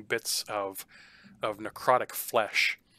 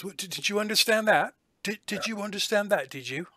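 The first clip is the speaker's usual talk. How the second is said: higher, mocking testing question; suspicious jailer; 9 semitones higher, faster than usual. Jailer quizzing the player on Abyssal, high mocking tone. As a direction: probe him, half sneering.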